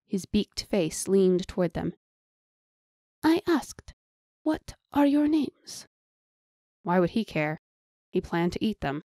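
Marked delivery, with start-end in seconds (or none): 3.2-5.9 high, drawn-out creature voice; slow, higher-pitched menacing question from a creature; demanding beast. Acted. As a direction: put on a strange high beast voice.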